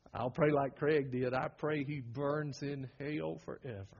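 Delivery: normal talking voice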